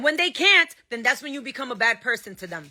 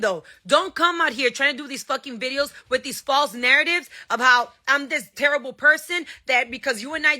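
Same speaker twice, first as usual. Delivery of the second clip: normal talking voice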